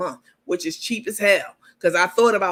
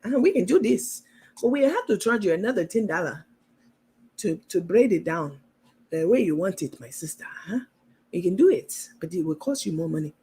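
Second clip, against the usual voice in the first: a touch of an African auntie haggling; African accent. Retold or imitated. Braider demanding extra money in a mild African accent. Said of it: "my African voice is on point".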